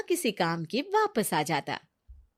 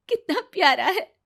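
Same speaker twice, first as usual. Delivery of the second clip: high, cooing delight with a little laugh; high-pitched tender admiration; adoring new mother; 9 semitones higher, 4 dB louder than usual. Acted. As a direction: coo over the newborn.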